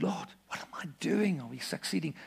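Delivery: hushed whisper to God; whispered doubt. Retold or imitated. Preacher whispers his doubts to God. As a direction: whisper the worried question.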